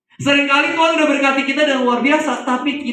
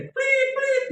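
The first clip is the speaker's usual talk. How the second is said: high squeaky whistle sound; whistle noise; 4 dB quieter than usual. Retold or imitated. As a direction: imitate a toy whistle, high. Child blowing a whistle. (bunyi peluit tinggi melengking)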